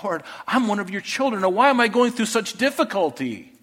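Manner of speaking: high-pitched, exasperated mock lament; overwrought complaint. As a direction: plead to the Lord in hammed-up frustration. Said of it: "Asaph was so overwrought with this whole idea".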